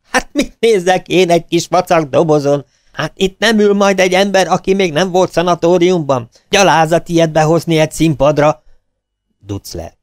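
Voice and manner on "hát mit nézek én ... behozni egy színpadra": high, loud angry explosion; outburst. A narrator's reading. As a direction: burst out in fury at the prop. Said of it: "Kitör".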